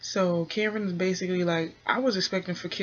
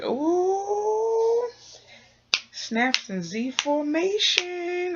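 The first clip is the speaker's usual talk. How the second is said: sassy ooh; 12 semitones higher, much slower than usual. Acted. Sassy cheer for Drita.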